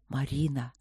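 low calling voice. A narrator's reading. Voice calling Marina out of the wind, low call.

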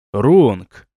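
loud, low call of a name. A narrator's reading. Narrator hero hails Ронг before the riders, loud call. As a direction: call out the name firmly and loudly. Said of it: "громко начал я".

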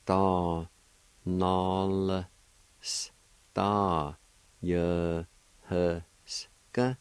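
Ta, nol, sta, ye, hus, ka each set apart by a pause; syllable by syllable. Shown as a demonstration. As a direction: give every syllable of Ta nol sta ye hus ka its own beat. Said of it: "like this".

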